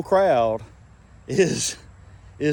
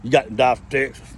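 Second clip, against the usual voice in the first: ordinary delivery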